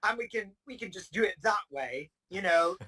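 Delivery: mock British accent with laughter creeping in; put-on British accent. Offering to carry on that way, mock British accent. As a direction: playfully fake a British accent, a little high. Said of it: "That's a good accent".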